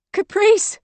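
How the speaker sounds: high, loud scream of the name; desperate scream. A narrator's reading. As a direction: scream down into the crevasse. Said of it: "she screamed".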